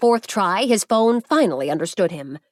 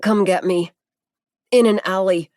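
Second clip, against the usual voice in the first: a hint of a man with a broken jaw, louder; 5 dB louder than usual. A narrator's reading. Injured Zeke begs Masaru for help, lightly voiced broken jaw. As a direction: push the words past a broken jaw. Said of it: "His mouth didn't work right".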